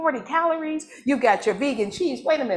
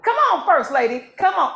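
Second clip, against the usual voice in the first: calling out; 7 semitones higher, 3 dB louder than usual. Real, not acted. Calling wife up to the kitchen.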